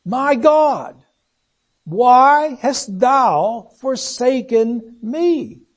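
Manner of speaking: slow, drawn-out grief-laden plea; very slow, heavy with sorrow, falling away at the end; grieving. Acted. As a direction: let the grief stretch every word. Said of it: "There's grief there".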